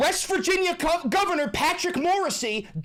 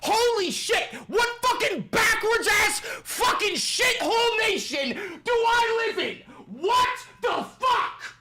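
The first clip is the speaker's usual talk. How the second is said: furious shouting; 2 semitones higher than usual. Real, not acted. Streamer screaming at the governor over false reports, real fury. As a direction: let the outrage explode at the governor.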